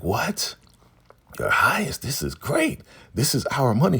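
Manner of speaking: high-pitched, excited retelling of River; thrilled disbelief. Retold or imitated. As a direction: act out amazed delight, voice shooting up. River reacts to two million dollars.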